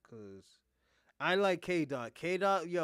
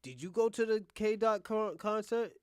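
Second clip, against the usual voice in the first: put-on raised, louder voice of an eager kid; imagined kid's question; 6 semitones higher, 8 dB louder, slower than usual. Kids ask dad about the concert, imagined eager kid. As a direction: lightly voice a kid asking dad. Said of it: "when your kids be like".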